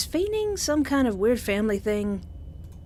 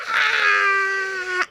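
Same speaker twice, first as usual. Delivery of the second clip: loud high startled shout; sudden loud yelp of surprise; 11 semitones higher, 6 dB louder than usual. Real, not acted. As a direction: jump at what appears on screen. Startled by the game scene.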